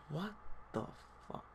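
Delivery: hushed mock disbelief; stunned whisper. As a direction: whisper it haltingly, as a bewildered thought. Retold silent bewildered reaction.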